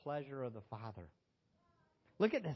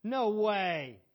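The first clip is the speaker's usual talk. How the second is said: mock protest; 3 semitones higher, 10 dB louder than usual. Mock Trinity arguing.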